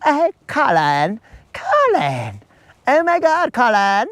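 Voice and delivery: a blushing woman gushing at Colin; mock flustered woman. Colin's crush, hammed-up swooning voice. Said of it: "she blushes and she'd be like".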